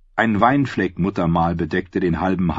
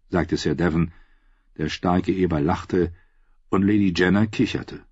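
ordinary delivery

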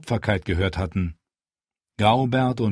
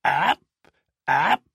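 screeching raven; 6 semitones higher, slower than usual. A narrator's reading. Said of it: "Kreischte der Rabe".

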